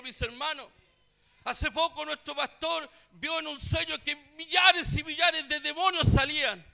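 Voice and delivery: preacher warning his brothers, full force; fervent preaching. Real, not acted. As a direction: thunder it from the pulpit.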